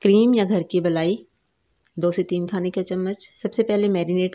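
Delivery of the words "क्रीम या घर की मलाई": usual speaking voice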